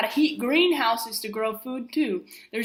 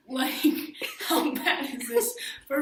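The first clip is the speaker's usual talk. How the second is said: laughing. Real, not acted. Laughs at long term.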